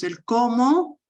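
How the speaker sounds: "cómo" slowed and lifted, given extra weight; stressed cómo. Shown as a demonstration. Teacher singling out the accented word for the class, slow stressed "cómo". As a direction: dwell on "cómo" slowly. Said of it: "la palabra cómo".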